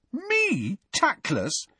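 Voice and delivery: high-pitched, indignant disbelief; affronted, incredulous questions; outraged. A narrator's reading. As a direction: throw it back, pitch leaping in outrage.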